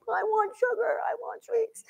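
put-on high, soft childish whine; a kid pleading for candy in a high whine; whiny child. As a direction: beg for sweets like a small kid. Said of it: "you were like".

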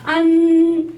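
안 drawn out long, high and loud; slow 안 with a nasal end. Shown as a demonstration. Teacher modelling a long nasal 안. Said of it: "이렇게 연습하시면 돼요".

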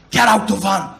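loud barked order; kidnapper shout. Retold or imitated. Kidnapper orders the comic out of the van, loud bark. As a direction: bellow it like a thug. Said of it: "he goes".

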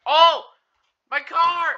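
high excited yell; excited shout. Real, not acted. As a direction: yelp about the car. Car moving in game.

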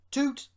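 high, loud engine toot; short high "toot"; answering whistle. A narrator's reading. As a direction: imitate an engine whistle in one short note. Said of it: "Rusty's answering".

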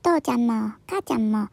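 ordinary delivery, nothing marked

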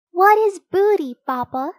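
put-on high-pitched, loud, curious child; little sister bear. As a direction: ask Papa like a puzzled little cub. Child bear asks her father.

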